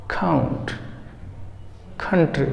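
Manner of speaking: काउंट with a breathy aspirated kh, like khaunt; कंट्री with a puffed kh at the start, khantri; aspirated English k. Shown as a demonstration. Teacher modelling English k for Hindi learners, aspirated kh. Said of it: "तो कुछ ऐसा बोलते हैं".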